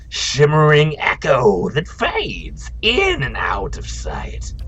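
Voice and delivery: an ethereal spirit sharing its vision. Acted. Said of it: "we'll do a spirit voice".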